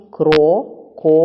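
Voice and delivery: "Крокодил" slowly as Кро, ко, дил with pauses; loud, separated syllables in "Крокодил": КРО... ко... дил; teacher dividing "Крокодил" piece by piece; split into syllables. Shown as a demonstration. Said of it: "Будьте уважні".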